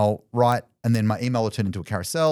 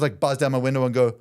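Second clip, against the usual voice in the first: usual speaking voice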